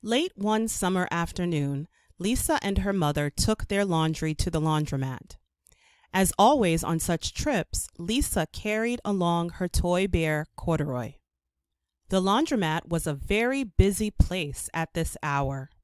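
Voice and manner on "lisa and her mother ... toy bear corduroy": ordinary delivery, nothing marked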